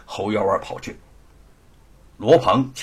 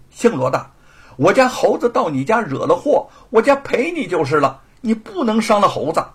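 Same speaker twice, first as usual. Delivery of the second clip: raised, firm pushback; turning firm; 8 semitones higher, 6 dB louder than usual. Acted. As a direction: stand up to the bully. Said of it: "不由得也强硬起来". (强硬起来地顶回去)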